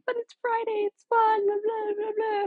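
high mocking whine of pushy friends. Mimicking friends who nag her to go out, high and mocking. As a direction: parrot their nagging up high. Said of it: "they're like".

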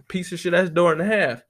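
usual speaking voice

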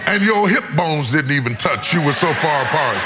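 a sermon climbing toward its climax; preaching. Acted. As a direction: preach it up to the peak.

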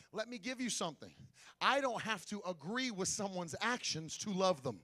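ordinary talk, nothing marked

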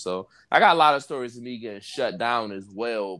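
usual speaking voice

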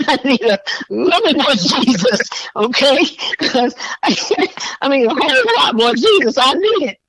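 can barely get it out for laughing; laughing plea. Real, not acted. Laughing at own plea for Jesus.

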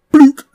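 high, loud hollow plonk of a plastic bottle; dull blook. Retold or imitated. For the crowd, imitating the plastic bottle hitting the wall: a loud, high blook. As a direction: make the sad thud of plastic on brick. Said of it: "He got this sound".

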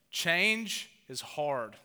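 "Change is hard" dragged out very slowly, every word weighted; slow emphatic statement. Acted. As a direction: let "Change is hard" land slowly like a verdict. Key point stated slowly.

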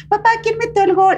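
put-on high, small child asking his dad; little boy voice. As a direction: play the kid from the ad, sweetly. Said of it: "el niño estaba asillito de las Chivas y dice".

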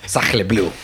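mock French exclamation; French accent. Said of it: "you sound like one".

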